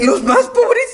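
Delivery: recalling her shock at the prayer; incredulous. Retold or imitated. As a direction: echo the words in disbelief.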